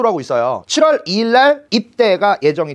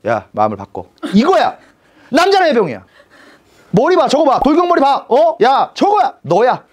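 friend's excited pitch; 8 semitones higher than usual. Retold or imitated. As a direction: hype him up like a friend selling a dream. Friend persuading him to join marines.

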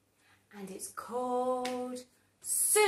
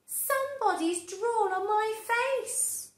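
upset Broccoli; 10 semitones higher, 6 dB louder than usual. Acted. Broccoli complains.

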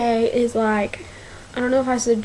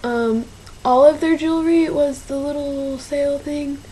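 ordinary delivery

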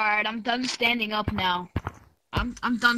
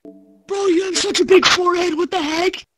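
high, loud drawn-out shriek; mock shock; 7 semitones higher, 5 dB louder, slower than usual. Real, not acted. Teasing the friend about his forehead, loud high mock shock. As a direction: overreact to tease him.

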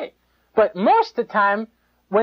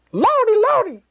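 much higher, a hammed-up Lordy; mock pious squeal. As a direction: lampoon the gushing believer. Mocking pious gospel talk.

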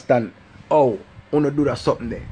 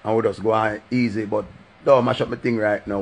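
usual speaking voice

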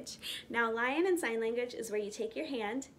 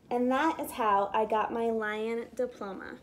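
ordinary delivery

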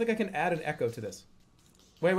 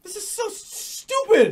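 high-pitched, bursting out louder on "stupid"; exasperated outburst; 13 semitones higher, 7 dB louder than usual. Real, not acted. Player reacting to a silly game, exasperated.

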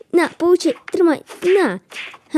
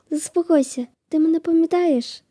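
ordinary delivery

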